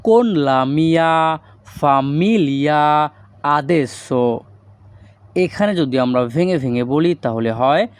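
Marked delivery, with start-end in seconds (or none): 0.0-4.4 very slow, word by word; slow Italian model. Shown as a demonstration. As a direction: spell out each Italian word slowly for learners. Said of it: "এই বাক্যের Italian ভাষা হবে". (খুব ধীরে ধীরে উচ্চারণ)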